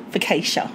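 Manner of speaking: "focaccia" said fo-KAY-sha, middle as in cake. Shown as a demonstration. Cohost mocks how some people say "focaccia", fo-KAY-sha. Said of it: "Or some people".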